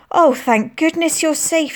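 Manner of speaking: slow, high and relieved. A narrator's reading. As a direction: breathe it out in relief. Aunt hugging children after bombing.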